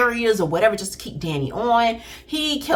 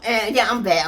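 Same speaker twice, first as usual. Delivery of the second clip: usual speaking voice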